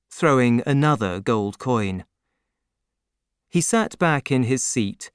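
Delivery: ordinary delivery, nothing marked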